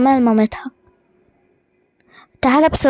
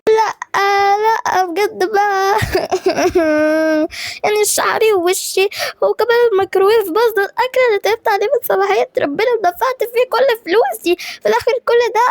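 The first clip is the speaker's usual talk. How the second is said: higher, tearful wailing complaint; crying; 6 semitones higher than usual. Acted. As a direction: whimper through tears over the ruined day. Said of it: "قاعدة بتعيط". (بتعيط وهي بتشتكي)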